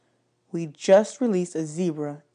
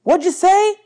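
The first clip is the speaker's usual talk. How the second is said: much higher, louder call from outside; shouting back; 13 semitones higher, 8 dB louder than usual. A narrator's reading. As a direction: yell through the trailer wall. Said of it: "shouted back".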